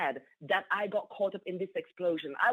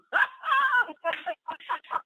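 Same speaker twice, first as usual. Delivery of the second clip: high shrieking burst of laughter; 4 dB louder than usual. Real, not acted. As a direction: shriek with joy. Celebrating the promotion.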